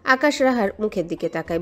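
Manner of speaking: ordinary delivery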